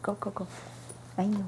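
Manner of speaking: ordinary talk, nothing marked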